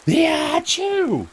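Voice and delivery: loud high hammed-up sneeze; fake sneeze. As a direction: blast a fake sneeze into the mic.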